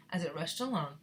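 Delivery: ordinary delivery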